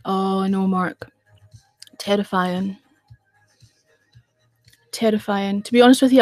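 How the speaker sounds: ordinary talk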